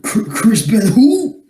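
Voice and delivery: high, slow, incredulous question; stammered, drawn-out high query; mock bafflement. Real, not acted. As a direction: climb high in disbelief on the last word. Mock disbelief at the name.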